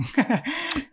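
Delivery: high, light chuckle; brief amused laugh. Real, not acted. Host amused by the love story.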